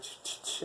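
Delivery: whispered chopping noise ch-ch-ch; unvoiced knife chop imitation; chopping sound. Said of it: "you're like".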